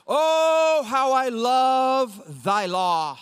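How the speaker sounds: slow, drawn-out, adoring reading of scripture; rapturous psalmist recitation. Acted. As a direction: linger on each phrase like a psalmist in rapture. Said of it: "Listen to how the psalmist would sing this".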